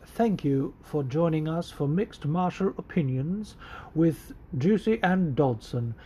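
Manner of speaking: slow, lightly put-on British accent; a hint of a British announcer accent; mock British accent. Host doing his show intro in a British accent. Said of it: "a British dialect".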